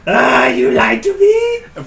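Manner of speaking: high, slow, very loud outraged cry; mock child's angry shout. Child cries out at the woman who fed her candy, mock outraged shout. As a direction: yell it like a betrayed little kid.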